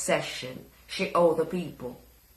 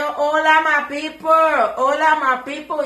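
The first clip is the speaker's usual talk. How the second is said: loud hola greeting; 7 semitones higher, 8 dB louder than usual. Real, not acted. Greeting Spanish viewers loudly.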